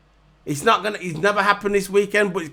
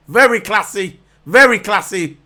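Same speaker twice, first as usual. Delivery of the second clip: sarcastic; 2 semitones higher, 7 dB louder than usual. Real, not acted. Mocking Bottas's post.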